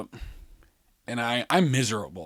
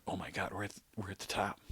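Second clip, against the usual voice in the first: very soft, low murmur; hushed mock dread; 5 semitones lower, 16 dB quieter, faster than usual. Rider realising he is at the top, hushed dread.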